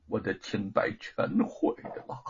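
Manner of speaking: soft, hollow voice of ruin; a touch of numb despair; anguished, bitter. A narrator's reading. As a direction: say it quietly, as if everything is lost. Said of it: "他的声音凄苦而痛楚".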